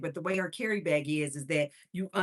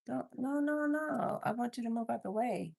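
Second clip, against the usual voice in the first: high, sing-song coaxing of the dog; 6 semitones higher, 6 dB quieter than usual. Real, not acted. Owner shooing her little dog aside, coaxing and high. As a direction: shoo the pet out of the way sweetly.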